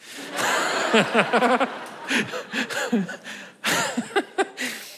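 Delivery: long high-pitched laughing fit; real delighted laughter. Real, not acted. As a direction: crack up at the answer. Titanic answer amuses him.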